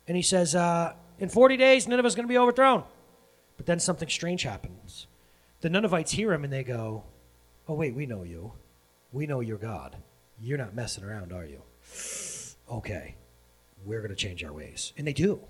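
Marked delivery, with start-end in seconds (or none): 1.2-2.8 high, loud mock street-preacher cry; prophet's proclamation. Jonah warns the Ninevites, proclaiming loudly. 7.7-14.9 low, soft, hesitant acted reply; humbled realisation. Ninevites hear Jonah and give in, subdued.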